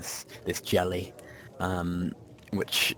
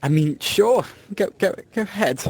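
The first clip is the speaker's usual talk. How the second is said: higher, louder startled consent; taken aback, then letting them go ahead; flustered go-ahead; 11 semitones higher, 7 dB louder than usual. Acted. Acts character's flustered reaction.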